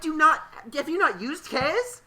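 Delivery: high, loud exasperated quote; exasperated laughing. Retold or imitated. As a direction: laugh out the disbelief. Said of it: "I'm like".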